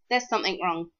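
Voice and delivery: plain reading voice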